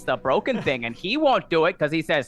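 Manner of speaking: a put-upon little gnome voice. Acted. Gnome behind the bar greeting a newcomer, high squeak.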